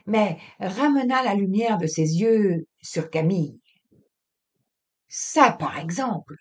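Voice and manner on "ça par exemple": plain reading voice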